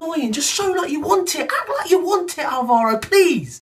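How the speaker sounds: high-pitched, pleading whine; exasperated plea. Real, not acted. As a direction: beg the player, voice pushed high. Pleading with the absent player.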